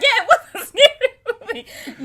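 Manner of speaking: very high, loud, through laughter; laughing mock answer. Replaying her answer to the movie guess, loudly laughing. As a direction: burst out laughing as you answer. Laughing re-enactment of misheard question.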